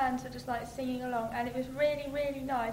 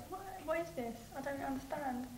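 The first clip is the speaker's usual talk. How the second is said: bewildered; 8 dB quieter than usual. Retold or imitated. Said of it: "in total bewilderment".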